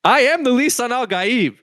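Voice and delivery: high-pitched, over-the-top proclamation; mock messiah proclamation. Player claims the gift of prophecy, mock messianic cry. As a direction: proclaim it high and grand, playing along.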